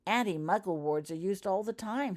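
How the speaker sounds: plain reading voice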